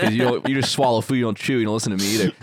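usual speaking voice

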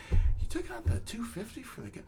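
can't believe they docked the beer; incredulous. Retold or imitated. Incredulous retort to bar pay.

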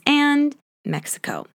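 normal talking voice

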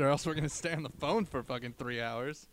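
ordinary delivery

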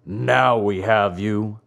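low, slow villain growl; threatening growl. Acted. Assassins seize Pinocchio by the neck, threatening growl. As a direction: drag it out low with menace. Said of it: "two horrible voices growled threateningly".

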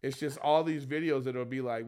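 usual speaking voice

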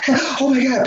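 high panicked cry; reliving the panic of sinking; panicking. Retold or imitated.

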